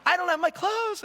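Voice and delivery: hammed-up complaint about the missing bag; whiny panic. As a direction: whine it like a stranded traveler. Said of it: "and I'm like".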